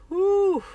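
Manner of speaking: shaking off a tearful memory with a whew; relieved whew. Real, not acted. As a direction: blow the emotion out in one breath. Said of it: "let me come off that, reliving that".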